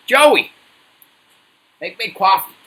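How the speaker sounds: high, loud put-on American call; American accent. Shown as a demonstration. Demanding coffee from Joey again, in a loud put-on American accent. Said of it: "That's my American accent".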